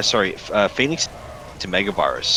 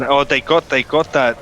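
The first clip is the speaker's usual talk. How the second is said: excited; 7 semitones higher, 4 dB louder than usual. Real, not acted. Caster reacts to bunker kill.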